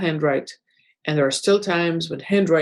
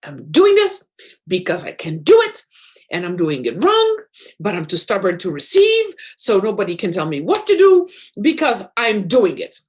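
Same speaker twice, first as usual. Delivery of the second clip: mock childish tantrum, punching the last words; six-year-old brat; 3 dB louder than usual. Host acting out the bratty unconscious mind to listeners, defiant child. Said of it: "like a six-year-old little brat".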